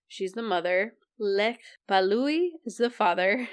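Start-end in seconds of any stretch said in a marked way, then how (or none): none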